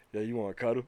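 low, quiet, tentative offer; soft coaxing. Retold or imitated. Girlfriend offers to make up after a fight, soft coaxing. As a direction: murmur it low, almost under your breath.

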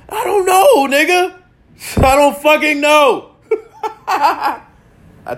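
high, loud laughing yell; laughing exasperation. Real, not acted. Answering critics about the snake, laughing out loud. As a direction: crack up while shouting the answer.

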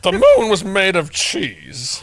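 slow, loud, rambling announcer; drawn-out loud baseball announcer; Harry Caray impression. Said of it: "Will Ferrell used to do an impersonation of him".

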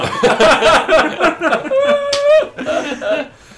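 high, loud, long cackle; long loud laughing fit. Real, not acted. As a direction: keep laughing hard.

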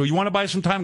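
high, brisk mock sales pitch; blunt salesman. A lazy salesman pitching radio time in a brisk mock voice. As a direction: rattle off the pitch. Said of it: "I go".

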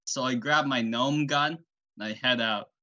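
normal talking voice

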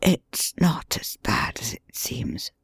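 faint, unvoiced whisper from an injured man; weak wounded whisper; weak mutter. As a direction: barely breathe the words out, slowly. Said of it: "he muttered in a very weak voice".

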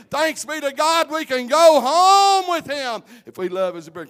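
loud, high shouted preaching, slowing on key words; preaching at full force. Acted. Preacher rousing the congregation about the crown, full-force shouting. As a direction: lift the church with every line.

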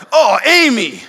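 loud, high burst of disgust at the smell; disgusted exclaim. Pretend. Dad opening a dirty diaper, loud disgusted cry. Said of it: "If I was like".

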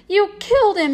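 high, loud outburst of grief; sobbing accusation. A narrator's reading. Boy accuses the hunter of killing his pet, sobbing. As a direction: cry it out as a pained accusation. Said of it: "he sobbed".

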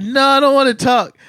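high, loud bratty refusal; child refusing loudly. Retold or imitated. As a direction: yell it like a stubborn kid. Avery refusing, voiced loudly.

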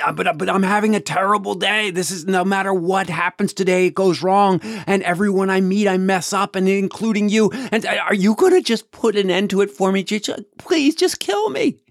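frantic pleading that climbs and cracks; desperate plea rising to a cracking cry. Retold or imitated. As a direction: beg harder and harder until the voice breaks on kill me. Mark pleading, breaking at end.

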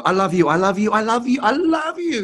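high, rising, gushing I love you; gushing declaration. Shown as a demonstration. Acting student rushing to a partner, gushing. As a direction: run at her pouring it out. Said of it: "you run to someone and go".

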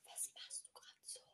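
breathy whisper, no voice. Real, not acted. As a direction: whisper so it can't be understood. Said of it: "Leute, ich flüster jetzt".

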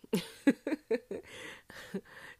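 long, high run of giggles; amused giggle. Real, not acted. Host looking back on her old self, high giggling. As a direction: giggle helplessly at yourself. Laughs at her own growth.

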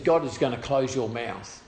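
normal talking voice

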